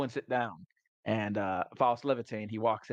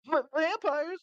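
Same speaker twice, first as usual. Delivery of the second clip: startled squeak; slower than usual. Retold or imitated. Party stunned by vampire talk.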